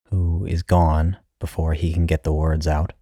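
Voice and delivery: ordinary delivery, nothing marked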